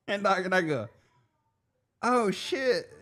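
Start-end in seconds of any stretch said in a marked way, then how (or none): 2.0-2.9 acted realization. Acting own reaction to the yell.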